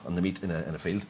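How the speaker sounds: ordinary talk, nothing marked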